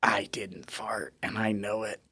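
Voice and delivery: soft unvoiced whisper; whispered thought. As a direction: breathe it as a secret.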